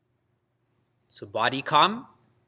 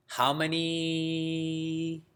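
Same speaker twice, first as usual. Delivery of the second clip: drawn-out quiz question; 6 dB quieter, much slower than usual. Real, not acted. Teacher quizzing class.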